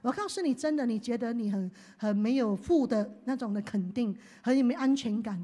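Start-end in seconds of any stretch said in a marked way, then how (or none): none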